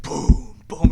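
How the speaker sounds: voiceless booming footfalls; dinosaur footsteps. Retold or imitated. Imitating Jurassic Park in the van, booming steps. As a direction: boom like a giant dinosaur. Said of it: "some guy started doing, like, Jurassic Park".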